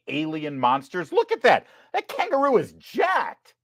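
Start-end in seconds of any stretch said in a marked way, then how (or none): 1.1-3.5 high, excited look at that; amazed outcry. Real, not acted. Host pointing out the jacked kangaroo, amazed outcry. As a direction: gasp it out in awe.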